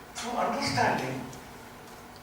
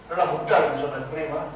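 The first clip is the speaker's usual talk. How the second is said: rapid, teasing Tamil insult; mock Tamil insult said fast; mock scolding in Tamil; 7 semitones lower, much faster than usual. Shown as a demonstration. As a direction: rattle off the insult playfully.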